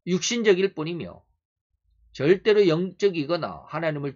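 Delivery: loud, emphatic sermon delivery; forceful preaching. Real, not acted. Preacher warning that life is all worldly, forceful preaching. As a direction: drive each phrase home to the congregation.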